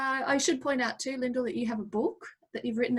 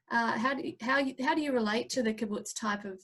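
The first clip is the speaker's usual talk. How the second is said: ordinary talk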